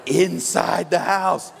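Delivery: low, slow, ominous police voice; ominous reveal. Retold or imitated. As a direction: drag out inside the house for dread. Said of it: "police reveal in horror story".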